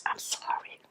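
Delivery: barely audible, choked-up whisper; emotional. Real, not acted. Apologising mid-interview after recalling her mother dying, emotional whisper. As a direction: whisper it, overcome with real emotion. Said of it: "I told you it would get emotional".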